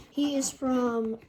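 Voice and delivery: ordinary talk, nothing marked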